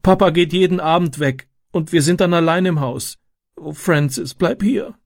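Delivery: put-on high, tearful child's plea; plaintive acted crying voice; sobbing. A narrator's reading. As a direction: beg like a little girl in tears. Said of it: "schluchzte sie".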